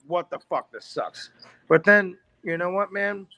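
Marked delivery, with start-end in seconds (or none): 0.1-1.3 quieter, deflated grumble of disappointment; a sulky mumble under the breath; muttered disgust. Retold or imitated. Teen sulks over his birthday apron, quiet and deflated. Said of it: "I'm like".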